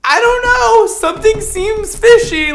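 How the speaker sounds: high, loud sing-out of doubt; dramatic suspicion. Real, not acted. Reviewer doubts the creator's sectioning, dramatically suspicious. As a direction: burst out high and loud with misgiving. Playful alarm about the technique.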